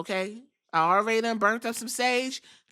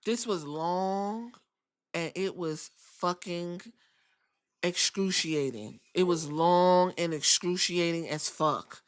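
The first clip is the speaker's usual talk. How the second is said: drawn-out complaint; 3 semitones lower, slower than usual. Real, not acted. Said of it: "I'ma be real honest with you".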